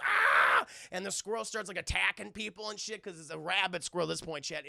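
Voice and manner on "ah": loud drawn-out mock scream; crowd scream. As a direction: scream as the whole panicking diner. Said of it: "Everyone goes".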